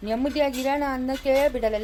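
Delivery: ordinary talk, nothing marked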